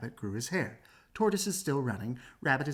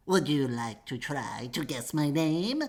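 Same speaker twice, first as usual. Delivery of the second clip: little man voice; 3 dB louder, slower than usual. Acted. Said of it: "said the clever little man".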